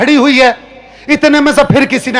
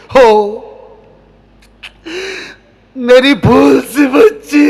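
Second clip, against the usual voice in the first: slow, hammed-up sobbing father; mock weeping; slower than usual. Father mock-weeping as his daughter leaves. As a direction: drag it out with a fake cry.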